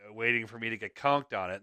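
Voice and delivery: ordinary talk, nothing marked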